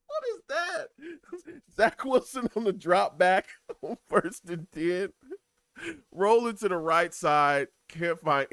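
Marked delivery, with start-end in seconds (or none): 0.1-5.1 high, helpless laughter breaking up the commentary; cracking up mid-call; laughing. Real, not acted. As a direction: try to call the play through laughter. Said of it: "I'm laughing at the Jets offense".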